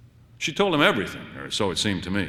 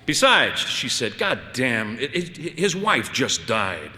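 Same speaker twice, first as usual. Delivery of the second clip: exasperated scolding; 7 semitones higher, slower than usual. Acted. Wife scolding husband about the blind man, exasperated. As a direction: scold him, then plead.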